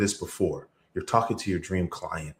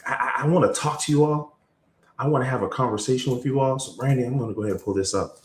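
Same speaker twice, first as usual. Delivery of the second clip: ordinary talk, nothing marked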